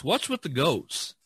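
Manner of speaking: ordinary talk, nothing marked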